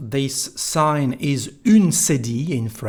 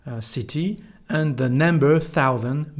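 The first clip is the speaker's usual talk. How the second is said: ordinary talk, nothing marked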